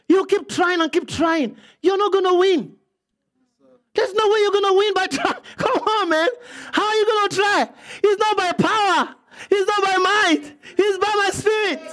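full-force pulpit preaching; fiery preaching. Acted. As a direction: preach it out with fire.

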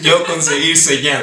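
broken caveman speech, laughing; caveman talk. Pretend. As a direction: grunt it like a caveman. Caveman talk after smoke signals joke.

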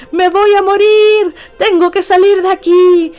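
retold panicked yell to her cousin; panicked shout. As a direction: blurt it out in full panic, nothing subtle.

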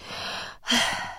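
long, soft breathy sigh; relieved sigh. Real, not acted. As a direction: let the breath out slowly. Sighs recalling a relaxing moment.

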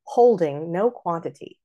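"Holding" as HOL-ding; natural trochee on "Holding"; corrected stress. Shown as a demonstration. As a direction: hit the first syllable of "Holding". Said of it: "it's not right".

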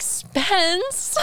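high-pitched mock swoon. Retelling her reaction to his confession, swooning. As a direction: swoon the name, high and light.

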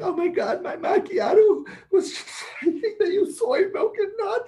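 high-pitched mock complaint about a macchiato, laughing; mocking a whiny coffee snob. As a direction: squeal it like a coffee order ruined your life. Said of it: "somebody's over there going".

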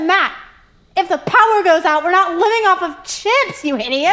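wife scolding husband over storm prep, loud and exasperated. Retold or imitated. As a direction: tell him off, exasperated. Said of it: "I said".

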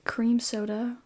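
ordinary talk

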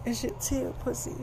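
put-on high, soft girlfriend voice; Tia's voice. Tia answering David in bed, high and soft.

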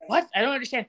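mildly startled retold reaction. Podcaster voices caught-out husband to co-host, mildly startled. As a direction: act out a taken-aback protest. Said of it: "Robert's startled".

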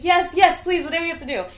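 high, sing-song eager yes; eager relief. Retold or imitated. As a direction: grab the offer gratefully. Eager kid answering dad.

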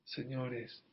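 normal talking voice

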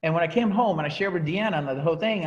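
ordinary talk

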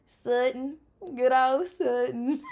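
lightly imitating a North Louisiana country accent on the name Sutton; drawled country Sutton, lightly imitated. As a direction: say Sutton like a little girl from Start, Louisiana. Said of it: "I just love to hear her say her name".

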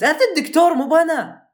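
furious shout. Retold or imitated. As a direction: throw the blame back at him, yelling. Retold quarrel with the doctor. (صرخة غاضبة في وجه الطبيب)

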